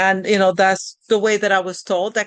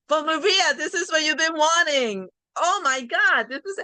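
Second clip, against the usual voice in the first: much higher, gushing delight; excited quote; 8 semitones higher than usual. Retold or imitated. Friend telling Maria this is what she wanted, excited high. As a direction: bubble over with happy disbelief. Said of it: "they were so happy".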